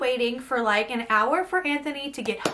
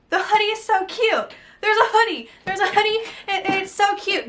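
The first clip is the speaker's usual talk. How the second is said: high-pitched, giddy squeal of delight; gushing; 7 semitones higher than usual. Real, not acted. Vlogger spots the hoodie in the box, squealing with delight. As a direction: gush over it like a thrilled fan.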